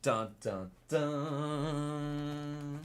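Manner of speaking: sung suspense notes, rising on the last; mock dramatic sting. Teasing the final pack, mock dramatic dun dun dun. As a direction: hum a movie drum-roll sting.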